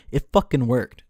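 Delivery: ordinary talk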